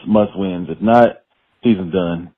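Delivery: usual speaking voice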